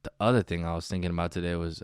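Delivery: usual speaking voice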